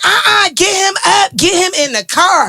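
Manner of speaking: high, slow mock-urgent order; urgent rescuers. Retelling the rescuers ordering dad into the car, high and urgent. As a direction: imitate the men hustling him into the car. Men rushing dad to hospital.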